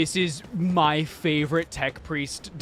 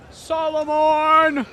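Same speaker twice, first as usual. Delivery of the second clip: high, slow, louder call of the name; 12 semitones higher, 3 dB louder, slower than usual. Real, not acted. Streamer calling out to her favourite character, high and drawn out. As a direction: call his name across the dock.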